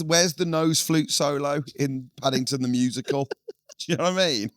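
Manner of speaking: ordinary talk, nothing marked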